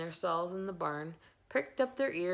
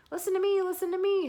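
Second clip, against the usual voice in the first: very high, loud goose scream; 10 dB louder, faster than usual. A narrator's reading. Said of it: "screamed the goose".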